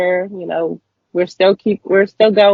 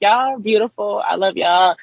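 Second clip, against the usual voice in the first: ordinary talk, nothing marked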